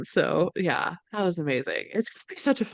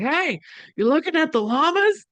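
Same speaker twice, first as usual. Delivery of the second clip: lightly voiced neighbour, pitched up; llama farmer; 9 semitones higher than usual. Farmer greeting teenagers at his fence, lightly imitated friendly call. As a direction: call over as the curious farmer, higher. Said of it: "and he's like".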